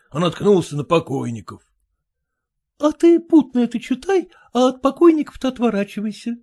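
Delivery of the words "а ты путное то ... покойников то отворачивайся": much higher, quick wife. Acted. Wife to husband about obituaries, quick high voice. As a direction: rattle off advice as the wife.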